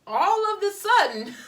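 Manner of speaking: all of the sudden flung up high and loud in disbelief; exasperated outburst. Real, not acted. Exasperated repeat of phrase.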